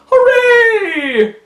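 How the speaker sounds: high, loud hammed-up cheer; gleeful cheer. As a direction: whoop it like delighted fools. Taoists cheer at the deities.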